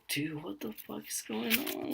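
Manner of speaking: high, hushed disbelief; soft high-pitched alarm; mock bewilderment. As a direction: squeak it quietly, as if aside to a coworker. Acting out staff's baffled reaction.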